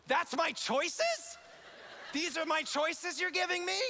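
high, slow disbelief; incredulous. Retold or imitated. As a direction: stretch it out in shock. Stunned at absurd choice.

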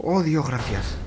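ordinary talk

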